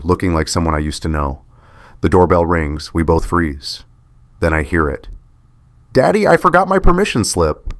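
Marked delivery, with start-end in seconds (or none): 5.9-7.7 put-on higher little girl calling out; child calling. Six-year-old daughter calling to her dad, high and bright. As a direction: call through the door like a small girl.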